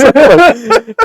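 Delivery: a big loud laugh breaking out; real laughter, loud and high; loud burst of laughter. Real, not acted. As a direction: laugh out hard at the memory. Laughing at own story.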